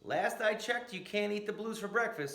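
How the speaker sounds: a stern grandma voice, pitched high; Grandma Della. Acted. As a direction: scold him like a stern grandmother. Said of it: "said Grandma Della".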